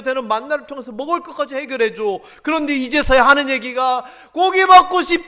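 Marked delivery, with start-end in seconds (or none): 4.3-5.3 loud whiny demand for meat; whining shout. Retold or imitated. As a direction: shout the craving like a spoiled crowd. People's complaint quoted in sermon. (고기 달라고 떼쓰듯 크게 외치기)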